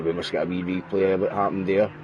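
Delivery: ordinary talk, nothing marked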